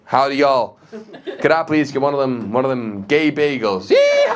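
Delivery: exaggerated Southern American accent; mock American cowboy. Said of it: "I try to be more American now".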